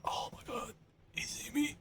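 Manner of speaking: hushed, voiceless whisper; tense whisper. Real, not acted. Player hiding from an enemy, tense whisper. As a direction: whisper as if he might spot you.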